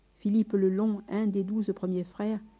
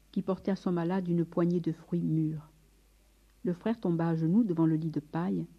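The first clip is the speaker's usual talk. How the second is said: usual speaking voice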